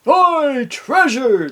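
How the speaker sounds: very high, loud gleeful cry; hammed-up crew shout; pirate shout. A narrator's reading. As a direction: yell it like an excited pirate. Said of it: "the other shouted".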